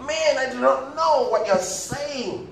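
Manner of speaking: slow, high, loud denial; Peter. Acted. Peter answering his accusers, drawn-out loud denial. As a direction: drag the loud protest out, pitched high. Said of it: "accuse Peter to be one of".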